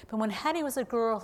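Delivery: ordinary delivery, nothing marked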